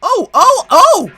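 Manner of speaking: very high, loud shrieks; screaming protest. A narrator's reading. Trucks as James backs onto them, shrieking protest. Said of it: "screamed the trucks".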